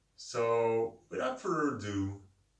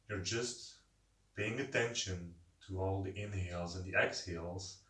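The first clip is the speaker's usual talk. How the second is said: guided meditation. Acted. Leads a seated breathing meditation.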